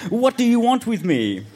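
high, theatrical plea; pleading. A narrator's reading. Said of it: "Then I pleaded".

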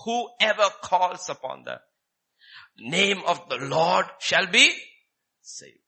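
slow, deliberate, each word weighted; slow emphatic verse. Acted. Preacher repeating the verse for emphasis, slow weighty. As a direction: let the promise land slowly. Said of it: "Very emphatic statement".

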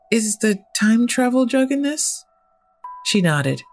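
ordinary delivery, nothing marked

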